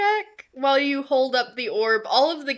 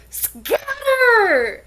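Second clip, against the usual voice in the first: high shouted "Scatter!", louder; shouted warning; 12 semitones higher, 8 dB louder than usual. Acted. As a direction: yell it like a kid fleeing. Kids scatter at your steps.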